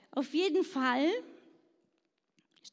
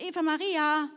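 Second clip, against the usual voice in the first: second call, higher and louder to get her attention; calling again, higher; 5 semitones higher, 5 dB louder than usual. Retold or imitated. Schoolgirl calls the unresponsive classmate again, higher and louder. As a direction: call her again when she didn't react. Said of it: "Die hat gar nicht reagiert".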